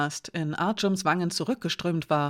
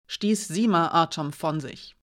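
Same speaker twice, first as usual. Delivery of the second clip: ordinary delivery, nothing marked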